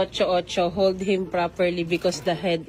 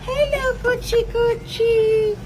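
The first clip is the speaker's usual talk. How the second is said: joyful reunion; 9 semitones higher than usual. Real, not acted. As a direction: coo at a beloved pet you missed. Greeting the pets again after time apart.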